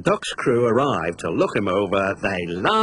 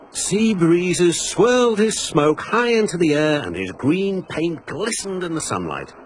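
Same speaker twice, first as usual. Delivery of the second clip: ordinary delivery, nothing marked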